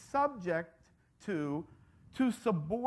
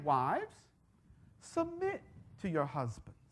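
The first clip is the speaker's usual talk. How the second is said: soft, low, gentle request; lowered voice, quietly appealing; asking, not telling; 7 semitones lower, 7 dB quieter than usual. Acted. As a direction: ask it gently, without commanding. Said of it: "Paul was asking. He wasn't telling".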